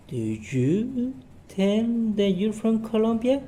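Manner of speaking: high, rising check question; bright questioning teacher voice; teacher's quiz question. Shown as a demonstration. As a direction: lift it up as a trick question.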